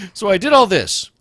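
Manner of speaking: shouting the crash with glee; gleeful shout. Retold or imitated. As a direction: yell it like you won. Excited retelling of crash.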